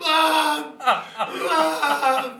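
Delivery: loud high wail for mum; drunk crying for mum. Retold or imitated. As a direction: howl Mum like a lost kid. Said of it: "he was like".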